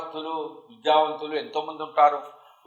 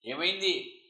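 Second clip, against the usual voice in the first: usual speaking voice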